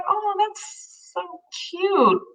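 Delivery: high, slow, half-crying coo; teary. Retold or imitated. Wife tells how she teared up at husband's words, crying softly. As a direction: melt into happy tears. Retold moved reaction.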